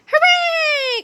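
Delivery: put-on high, squealing kid cheer; children cheering. A narrator's reading. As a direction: cheer like a classroom of happy kids. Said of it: "cried the children".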